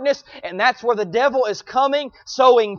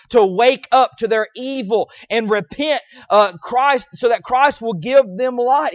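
ordinary delivery

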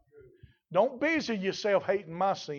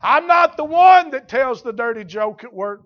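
loud, higher mock churchgoer's protest; indignant protest; 6 semitones higher, 12 dB louder than usual. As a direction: protest your innocence loudly. Voices an objecting churchgoer.

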